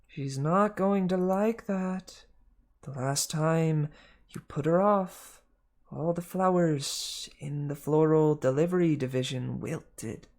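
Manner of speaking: high slow female snake; Martha the snake. Acted. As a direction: announce the call like a receptionist. Martha warning Hermes.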